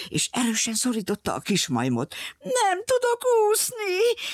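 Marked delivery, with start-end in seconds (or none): 2.4-4.2 put-on very high, slow little-girl whine; whimpering. A narrator's reading. Little girl fears the lion jumping seaward, high whimper. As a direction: whimper it like a tearful child. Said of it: "pityergett a kislány".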